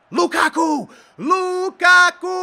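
loud, high, stretched-out shout; excited name-calling. Real, not acted. Gamer cheers Lukaku on, excited drawn-out shout. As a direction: draw out the striker's name as a cheer.

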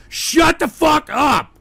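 loud, drawn-out exasperated order; angry shout. Real, not acted. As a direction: shout the chat into silence, slowly. Scolding viewers for interrupting.

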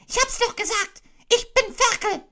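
a vindicated little pig; triumphant Piglet. Acted. Piglet triumphing over Kanga.

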